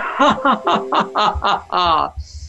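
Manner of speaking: hearty demonstration laugh; demo laughter. Shown as a demonstration. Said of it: "So breathe in".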